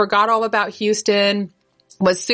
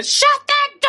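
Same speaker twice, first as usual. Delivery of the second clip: very high, shrill-pitched yell; child's screaming demand. Retold or imitated. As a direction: scream at the bellman like a tantruming kid. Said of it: "he's met with the".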